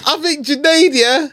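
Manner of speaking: high-pitched cracking laughter; laughing. Real, not acted. Telling the mates about Junaid, high helpless laughter. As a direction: laugh so hard the voice squeaks. Breaks up laughing mid-story.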